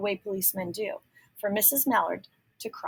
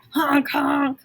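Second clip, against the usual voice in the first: high, loud honking like a car horn; car horn imitation; 6 semitones higher, 8 dB louder than usual. A narrator's reading. Reading to children, imitating the car horns loud and high. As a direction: blare it out like a speeding car. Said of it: "went the horns of the speeding cars".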